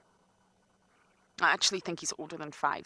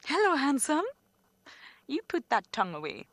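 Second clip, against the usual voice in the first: affectionate; 8 semitones higher, 6 dB louder than usual. Real, not acted. Greets leopard affectionately.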